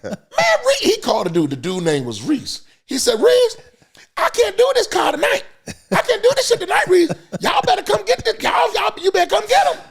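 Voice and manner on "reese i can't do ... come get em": high, loud, shouted desperate whine; much higher exhausted shouting driver; driver pleading to boss. Retold or imitated. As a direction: wail it like a worn-out driver. Driver complaining on phone.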